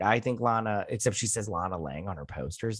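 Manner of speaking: normal talking voice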